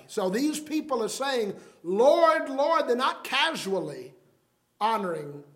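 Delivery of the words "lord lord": "Lord" higher and louder, falling; emphatic Lord Lord. Retold or imitated. Religious people calling on Jesus, emphatic. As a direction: call out "Lord, Lord" in earnest. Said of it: "is an emphatic way".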